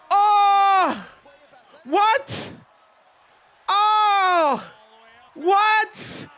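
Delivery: high, loud stunned yelps of disbelief; shocked shriek. Real, not acted. Hearing the sister-in-law's knee story, shrieking in shock. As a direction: shriek it like you can't believe what you just heard.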